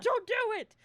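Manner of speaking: high, loud warning cry; mock alarm. Boyfriend pulling her back, mock alarm. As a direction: act out stopping someone in a panic.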